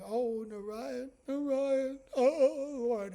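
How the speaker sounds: a worn-out grandma weeping for help; weeping old woman. Acted. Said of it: "she's crying".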